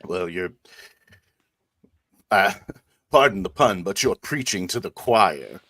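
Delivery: normal talking voice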